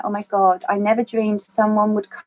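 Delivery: ordinary talk, nothing marked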